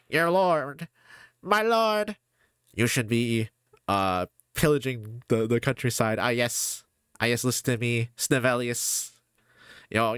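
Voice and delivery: high, scheming courtier voice with slow relish; evil royal advisor. Acted. Streamer acts a villainous advisor urging his king, sly scheming voice. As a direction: purr advice to the king like a villainous vizier. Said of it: "evil advisors to royalty".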